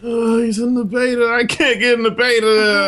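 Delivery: mock whining. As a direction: whine in mock envy about being left out. Mock lament at missing beta.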